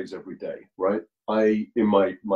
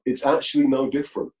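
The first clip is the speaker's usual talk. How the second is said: ordinary talk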